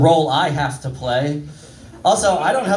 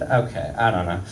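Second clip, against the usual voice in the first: ordinary delivery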